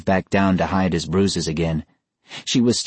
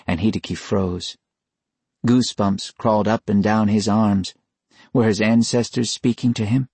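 ordinary delivery, nothing marked

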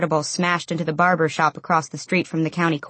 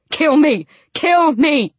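high, loud repeated cries; shouting; 9 semitones higher, 7 dB louder, slower than usual. A narrator's reading. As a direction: shout each plea desperately. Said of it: "He shouted every time his head hit".

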